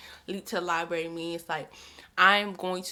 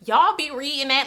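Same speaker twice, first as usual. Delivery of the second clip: louder, higher, incredulous "Y'all be reading that?"; judgy disbelief; 6 semitones higher, 8 dB louder than usual. Shown as a demonstration. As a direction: throw out "Y'all be reading that?" in loud shocked judgment.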